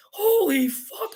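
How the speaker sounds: drawn-out, stunned "holy fuck"; imitating his shock. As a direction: play the guy gaping into the trunk, slow and high. Firefighter shocked at the trunk.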